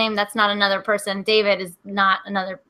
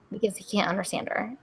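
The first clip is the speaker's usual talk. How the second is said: normal talking voice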